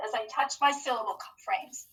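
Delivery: ordinary talk, nothing marked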